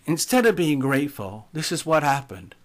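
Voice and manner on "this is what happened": usual speaking voice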